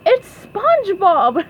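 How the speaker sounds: high, loud amused exclamation; acted giggles around the words; Sandy laughing. A narrator's reading. Sandy spots SpongeBob under the costume, high laughing voice. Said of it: "Everyone laughed at SpongeBob".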